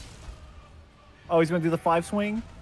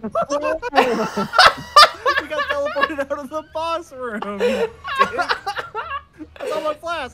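high laughing outburst; 7 dB louder than usual. Real, not acted. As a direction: squeal it out laughing. Laughing at being teleported out.

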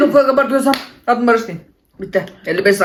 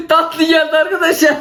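giddy laughing win; laughing with delight; 6 semitones higher, slower than usual. Real, not acted. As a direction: laugh through the words. Got the sweet one.

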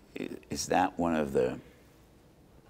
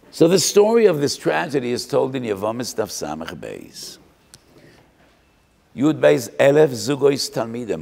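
full-force preaching; 6 semitones higher, 8 dB louder than usual. Acted. Preacher announcing the tragedy.

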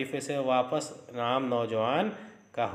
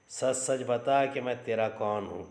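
plain reading voice

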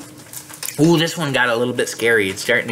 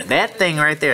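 plain reading voice

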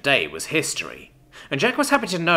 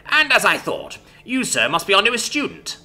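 cheerful posh voice; 11 semitones higher than usual. A narrator's reading. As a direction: greet her brightly like a jolly old teacher. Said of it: "a cheerful posh voice spoke up".

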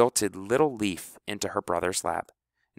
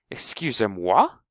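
louder, indignant French "Excusez-moi"; affronted French; 2 semitones higher, 6 dB louder than usual. A narrator's reading. Finesse, interrupted by House, bristling in a French accent. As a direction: bristle in French at being cut off.

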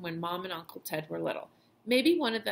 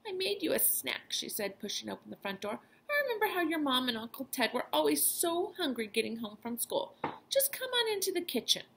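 grandma voice; 6 semitones higher than usual. Acted. Grandma offers Dexter a snack.

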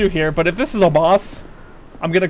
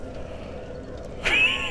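breathless high-pitched laugh; high squealing laugh; 10 dB quieter than usual. Real, not acted. Streamer laughing about being slapped by the boss, high squealing laugh. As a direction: let a helpless squeal of laughter out.

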